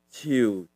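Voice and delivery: ciu low and falling; tree tone. Shown as a demonstration. Teacher runs through three tones, ciu low falling. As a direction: sink the pitch on ciu.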